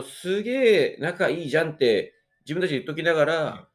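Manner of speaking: usual speaking voice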